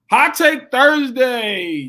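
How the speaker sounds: host introducing a podcast segment, announcer voice. Acted. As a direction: announce the segment with a big flourish.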